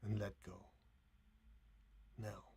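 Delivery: soft, unhurried phrases with long pauses; guided meditation. Acted. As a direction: gently guide them back from stillness, barely above a hush.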